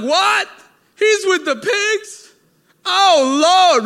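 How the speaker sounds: high, loud, scandalized disbelief; shocked crowd gasp. Retold or imitated. Preacher voicing a stunned crowd hearing the story, shocked high cry. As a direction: squeal it like you just heard gossip. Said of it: "People like".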